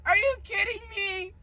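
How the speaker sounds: high, cracked disbelief; incredulous. Retold or imitated. Telling viewers how she reacted to his mom, high and incredulous. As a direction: ask it like you can't believe it.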